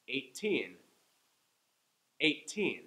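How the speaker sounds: put-on Eighteen with the teen stressed; eigh-TEEN, second syllable strong. Shown as a demonstration. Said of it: "I'm saying each one twice".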